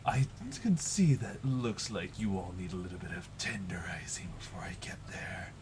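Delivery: whispered demon with a chuckle; a dark entity whispering promises; demonic whisper. Acted. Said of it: "a demonic voice hover over the entire ritual".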